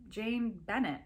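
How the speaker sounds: plain reading voice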